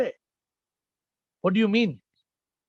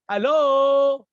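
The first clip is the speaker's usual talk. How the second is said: loud, higher drawn-out hello; phone greeting; 5 semitones higher, 7 dB louder than usual. Acted. Teacher mimicking people picking up the phone, exaggerated phone greeting. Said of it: "you pick the phone and you say".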